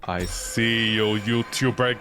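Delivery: slow menacing spirit. Pretend.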